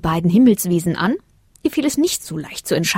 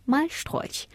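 ordinary delivery

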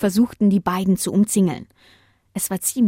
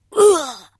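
sharp high yelp as he's struck; wolf crying out, flung into the abyss; wolf's yelp; 8 semitones higher, 5 dB louder than usual. Acted. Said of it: "jauchzte der Wolf".

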